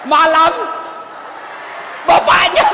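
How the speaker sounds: loud and high, slowly spaced; pause, then land each word; punchline stress. Shown as a demonstration. Preacher delivering the punchline to the mothers, loud. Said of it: "punchline with long pause".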